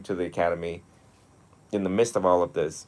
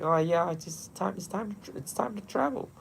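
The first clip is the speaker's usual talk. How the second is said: mock chill; 10 semitones higher, 7 dB quieter than usual. Imitating his own laid-back self, airy and casual. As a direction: toss it off as if nothing matters.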